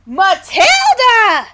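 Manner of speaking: high, loud, slow shout; confused exclamation. A narrator's reading. Birds exclaiming in confusion at Matilda. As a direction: call her name in disbelief. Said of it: "the birds exclaimed in confusion".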